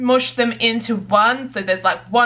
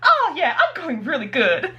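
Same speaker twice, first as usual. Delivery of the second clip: much higher, giggly character line; bubbly character voice; 6 semitones higher than usual. Acted. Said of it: "having them talk like".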